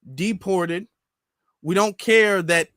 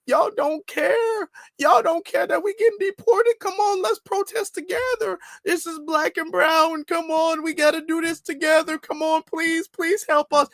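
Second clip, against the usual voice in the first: much higher, whiny mock plea; mocking pleading voice; 10 semitones higher than usual. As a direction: parody a begging online video, pitched way up. Mocks online pleas for protest help.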